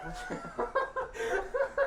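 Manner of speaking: shrill burst of laughter; high squealing laugh. Real, not acted. Laughing at crashes.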